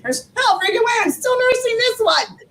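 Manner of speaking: high, squealing disbelief; retold disbelief. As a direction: imitate yourself refusing the news. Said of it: "I'm like".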